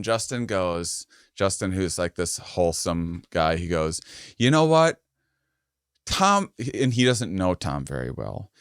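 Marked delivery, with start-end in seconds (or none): 4.4-6.5 calling out. Retold or imitated. Justin calls out to Tom.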